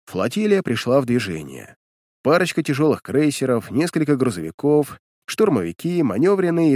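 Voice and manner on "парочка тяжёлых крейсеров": plain reading voice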